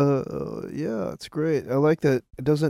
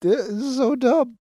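exasperated; 10 semitones higher than usual. Real, not acted. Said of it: "complaining about the edit".

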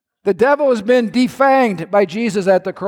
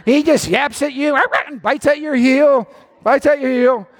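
playful scorn; 7 semitones higher than usual. Retold or imitated. Describing yapping dog devil.

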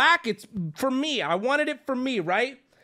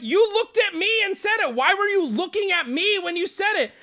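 much higher, pressed and indignant; high indignant yell; 10 semitones higher than usual. Retold or imitated. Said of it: "employees heard me yelling".

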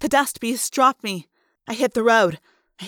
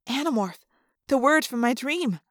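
usual speaking voice

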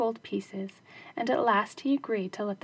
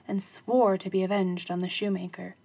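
ordinary delivery, nothing marked

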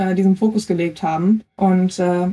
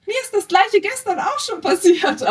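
much higher, put-on voice; retold fellow athlete; 11 semitones higher than usual. Athlete retells a fellow athlete coming up to her, high-pitched imitation. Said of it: "eine Athletin dann auch zu mir gekommen".

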